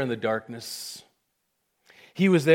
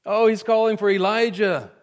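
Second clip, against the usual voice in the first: higher, louder jeering crowd; mocking; 6 semitones higher, 6 dB louder than usual. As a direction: taunt him like a scornful onlooker. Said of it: "they mocked".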